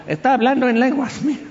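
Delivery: high, laughing mock exclamation; mock a gullible churchgoer, pitched high; mocking bystander. A gullible bystander pointing at a stroke victim, high mocking voice. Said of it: "entonces vas a decir".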